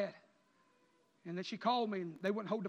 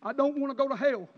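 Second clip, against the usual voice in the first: defiant cry; 4 semitones higher, 11 dB louder than usual. Retold or imitated. Sinner's defiant reply voiced by preacher.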